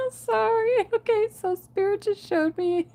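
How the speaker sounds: high, loud laughter breaking through the words; can't stop laughing while trying to talk; real giggling fit. Real, not acted. Reader cracking up at a vision from Spirit, laughing. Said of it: "don't know why I'm laughing".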